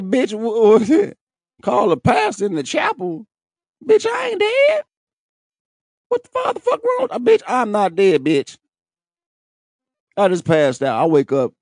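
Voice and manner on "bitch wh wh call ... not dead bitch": outraged disbelief. Retold or imitated. Waking up after fainting, high outraged mock yell at the lady. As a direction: shout it like you can't believe they called the pastor. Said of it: "I'm like".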